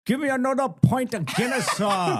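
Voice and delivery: high, sing-song Indian-Irish brogue; an Indian man gone Irish, ordering a pint; Indian-Irish accent, high and sing-song, ordering a pint. Retold or imitated. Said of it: "an Indian accent and an Irish accent".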